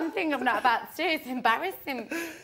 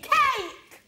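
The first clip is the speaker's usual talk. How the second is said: exasperated outburst; 12 semitones higher, 4 dB louder than usual. Real, not acted. Punchline about meeting a celebrity.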